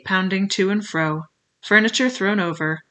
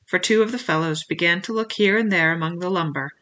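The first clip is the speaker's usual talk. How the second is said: plain reading voice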